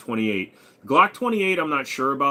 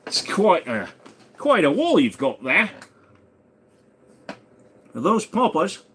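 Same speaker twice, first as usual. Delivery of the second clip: jokey put-on Australian accent, higher and louder; mock Australian accent; 8 semitones higher, 4 dB louder than usual. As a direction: play at an Australian admiring the gun wall, mixing in other accents. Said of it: "I'm mixing all the accents".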